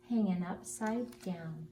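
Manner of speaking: ordinary delivery, nothing marked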